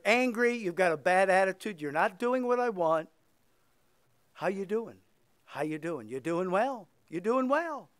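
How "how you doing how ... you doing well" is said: sarcastic. Acted. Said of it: "that was a sarcastic question".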